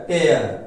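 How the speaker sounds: टेयर with an "air" sound; the ripping sense, टेयर; pronounced tair. Shown as a demonstration. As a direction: say टेयर to rhyme with chair. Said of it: "उच्चारण देखिए अलग हो जाएगा".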